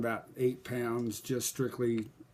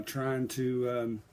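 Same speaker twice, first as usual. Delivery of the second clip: ordinary delivery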